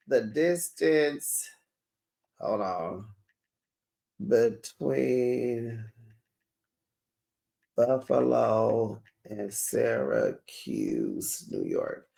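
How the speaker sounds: slow, low and soft, word by word; absent-minded search talk. Real, not acted. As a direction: talk to yourself while typing a search. Talking to herself while searching online.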